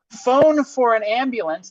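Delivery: loud, slow, emphatic reading; all caps reading. Shown as a demonstration. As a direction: read it like shouting in capitals. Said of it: "This is in all caps".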